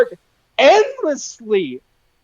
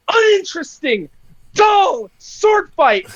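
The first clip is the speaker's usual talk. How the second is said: ordinary talk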